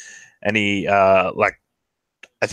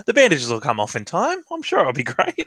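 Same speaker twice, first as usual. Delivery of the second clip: higher, lilting character voice; cheerful reassurance in a raised, swooping pitch; upbeat Lug; 7 semitones higher than usual. Acted. Lug plays down his bandages, high and upbeat. Player voices Lug's reply.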